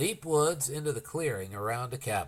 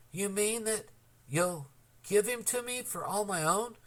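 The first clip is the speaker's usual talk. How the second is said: higher, eager disbelief; awed hope; 8 semitones higher than usual. A narrator's reading. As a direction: ask as if it's too good to be true. Boy trembling, asking slowly.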